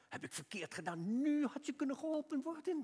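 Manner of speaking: acted grumble climbing high in pitch; retold inner griping; grumbling to oneself. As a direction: complain to yourself, rising into a mock whine. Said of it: "Dus ik zat in mezelf te mopperen".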